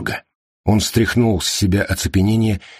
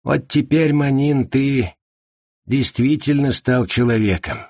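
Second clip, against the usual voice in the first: higher, heavy gloomy words; 7 semitones higher than usual. A narrator's reading. Li tells the AI grimly it became human. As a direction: say it with a grim sigh of acceptance. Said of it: "и угрюмо сказал".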